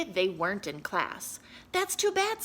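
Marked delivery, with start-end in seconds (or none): 1.7-2.4 high fussy principal; lightly voiced fussy principal. Principal's voice in story.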